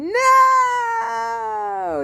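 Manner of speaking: high, sharp cry of No!; child crying out as it's dragged. Acted. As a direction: cry out in fright. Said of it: "you cried".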